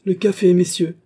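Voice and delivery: high, loud call to the company; ringing, elated summons; delighted call. A narrator's reading. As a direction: announce it gleefully to the whole room. Delighted marshal calls for coffee.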